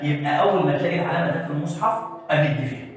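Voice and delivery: normal talking voice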